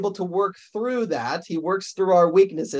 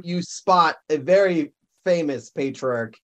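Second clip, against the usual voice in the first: usual speaking voice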